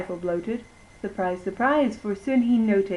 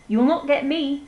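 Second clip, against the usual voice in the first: piggy cry; 6 semitones higher, 5 dB louder than usual. Acted. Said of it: "the piggy cried".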